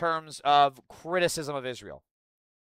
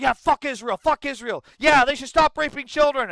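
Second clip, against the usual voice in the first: mock eager leftist; 9 semitones higher, 5 dB louder than usual. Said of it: "people on the left are like".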